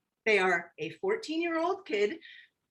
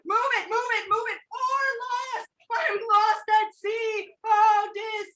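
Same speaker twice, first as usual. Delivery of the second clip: much higher, shouted cries in a storm; captain crying out; 12 semitones higher than usual. Acted. The captain cries out into the stormy night, shouting. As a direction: yell over the thunder and waves. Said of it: "crying out in the night".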